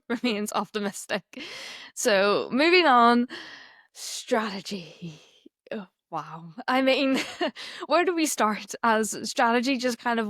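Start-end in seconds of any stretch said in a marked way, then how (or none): 3.9-5.2 breathy whispered title, slowed right down; dramatic whisper. Acted. Co-host announcing the next topic in a hushed whisper. 5.7-6.5 hushed oh wow. Real, not acted. Hushed reaction.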